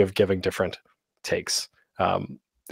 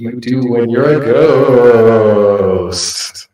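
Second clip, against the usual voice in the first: reverb; 3 dB louder than usual. Shown as a demonstration. Presenter demonstrating reverb on the mixer, echoey effect. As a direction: voice washed in heavy reverb. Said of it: "that's the one thing I can demonstrate".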